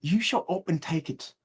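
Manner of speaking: soft, put-on English accent; England voice. Comic trying his England voice on an order, English accent. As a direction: try out an English accent, quietly. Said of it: "Gotta do it in my England voice".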